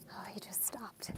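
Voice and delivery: hushed whisper. Real, not acted. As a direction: whisper it so he can't hear. Passing a man on lawnmower.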